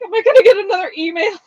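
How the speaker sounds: high, loud, rushed worry; acted nervousness. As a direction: fret it out fast, pitch jumping up. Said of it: "I'm always nervous".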